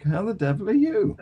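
ordinary delivery

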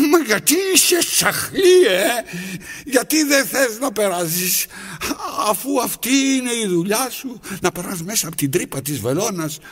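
high, loud scolding; shrill-free loud reproach, high up; exasperated scolding. Acted. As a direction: tell off a stubborn thread. Scolds the stubborn thread.

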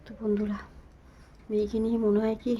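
normal talking voice

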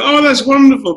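high, loud fake enthusiasm; mock delight. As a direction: gush as if thrilled. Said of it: "I'd be like".

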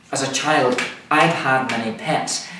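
normal talking voice

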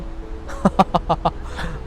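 short airy scoffing laugh; a quick incredulous breath-laugh; breathy chuckle. Real, not acted. Scoffing at club's bankruptcy.

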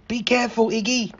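ordinary delivery

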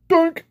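high-pitched cartoon bonk noise; short, high comic impact sound; imitated clonk on the head; knock-out bonk. Imitates Bilbo getting knocked out.

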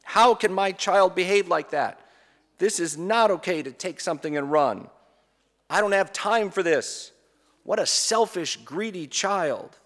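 mock furious parent, slowing at the end; losing control. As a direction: vent like a fed-up parent. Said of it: "we lose control".

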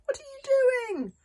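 high, loud, slow drawn-out call; desperate long-distance shout; shouted into the mist. Acted. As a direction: cup your hands and call far away. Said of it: "shouted through the sea mist".

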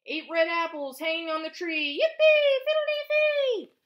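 big bright storybook chant, shouted yippee; gleeful refrain. A narrator's reading. Teacher reading to preschoolers, loud gleeful chant.

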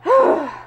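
loud, high exasperated sigh. Acted. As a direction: blow out all the frustration. Boss exasperated after resignation.

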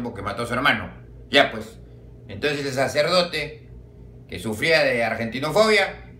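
ordinary delivery

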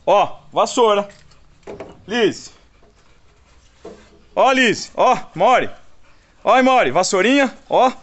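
high, loud playful call; excited calling. Real, not acted. Owner waves a broom to excite his dogs, high and loud.

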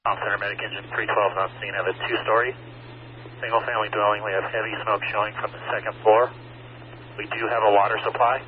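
muffled radio transmission, quiet and fast; crackly handheld radio report from scene; urgent firefighter radio. Real, not acted. Crew radios from fire scene.

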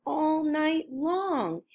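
usual speaking voice

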